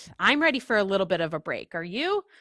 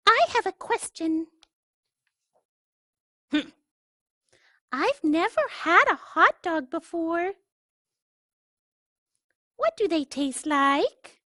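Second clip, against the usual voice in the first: higher, sweet little duckling voice; curious duckling; 9 semitones higher, 4 dB louder than usual. Acted. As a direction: innocent questions from a tiny bird. Duckling voice asks.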